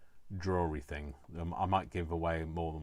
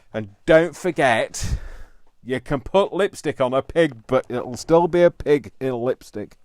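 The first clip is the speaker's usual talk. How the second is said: mock solemn proclamation; 5 semitones higher, 13 dB louder than usual. Mock proverb delivered emphatically.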